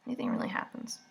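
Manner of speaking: whispered question to herself; half-whispered aside to herself. Real, not acted. Vlogger mock-asks herself.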